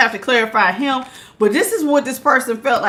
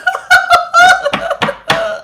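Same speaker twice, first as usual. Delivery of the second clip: high, nervous shriek of laughter; 3 dB louder than usual. Real, not acted. Spooked by a spider, she shrieks with laughter. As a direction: laugh it off in high-pitched panic. Scared, feet tucked.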